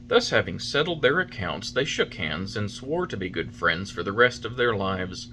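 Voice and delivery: ordinary delivery, nothing marked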